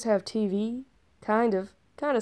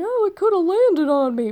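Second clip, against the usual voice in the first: chirpy Bev; 9 semitones higher, 6 dB louder than usual. Retold or imitated. Bev's cheerful view voiced.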